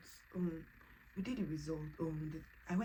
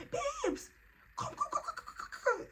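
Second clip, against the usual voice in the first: high excited shouting; excited calling. Retold or imitated. Calling her husband to see the test. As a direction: call him in, bursting with news.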